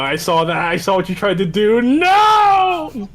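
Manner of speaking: escalating real objection; building protest. Real, not acted. Building protest at friend's opinion.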